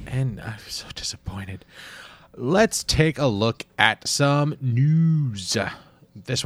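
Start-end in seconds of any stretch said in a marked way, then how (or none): 0.4-1.7 half-joking whisper; a whispered, hammed-up sigh of disappointment; mock disappointment. Real, not acted. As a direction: breathe it out as if crushed, tongue in cheek. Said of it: "own state, mock whisper".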